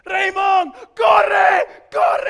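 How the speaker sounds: high, full-force shout with Spanish pronunciation; very loud mock-panicked Spanish yell; yelled alarm in Spanish. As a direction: scream a fake warning in Spanish. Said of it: "But this was in Spanish".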